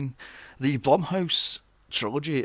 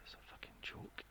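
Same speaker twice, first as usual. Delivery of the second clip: whispered disgust; 25 dB quieter than usual. Real, not acted. Muttered disgust.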